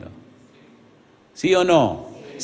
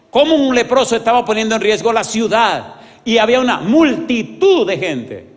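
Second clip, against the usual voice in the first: louder, higher preaching at full force; preacher pressing the crowd, louder and higher; fiery preaching; 6 semitones higher, 4 dB louder than usual. Acted. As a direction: drive the questions home to the congregation.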